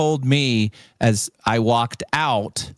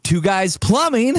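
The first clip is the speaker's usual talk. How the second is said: much higher, chirpy phone greeting; mock phone answer; 12 semitones higher, slower than usual. Answering the casino's call, mock cheerful high greeting.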